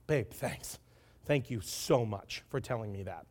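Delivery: low, soft acted gratitude; hushed thanks. Wife thanking husband for telling her, hushed. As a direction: drop right down and murmur the thanks.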